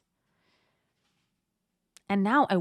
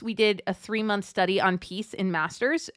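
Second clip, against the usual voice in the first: normal talking voice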